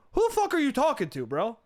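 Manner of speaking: high-pitched, incredulous challenge; hammed-up outrage, pitched well up; mock indignant comeback. Comic retelling his comeback to a doubter, mock indignant. Acted comeback in retold argument.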